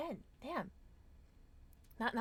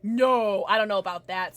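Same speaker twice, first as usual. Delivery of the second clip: loud reluctant protest; 13 dB louder than usual. Real, not acted. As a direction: push back, loud. Reluctant refusal.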